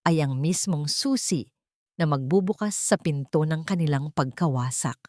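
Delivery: ordinary delivery, nothing marked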